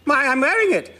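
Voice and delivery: high, surprised little lift; as if it were obvious. Acted. Vicar surprised at the question.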